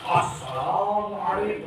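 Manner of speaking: drawn out like a sermon, slow and hushed; the wrong way, a slow sermon-style greeting; preachy greeting. Shown as a demonstration. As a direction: intone it like a preacher opening a sermon. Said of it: "itu ceramah".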